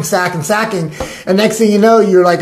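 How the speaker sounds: ordinary talk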